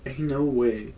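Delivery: a hint of holding back tears; imitating a heartbroken friend, quietly; mock crushed. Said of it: "he just starts crying".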